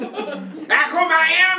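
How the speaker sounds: long high shouted "Aquaman!"; drawn-out shout. Pretend. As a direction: stretch it into a big high yell. Villain shouting name.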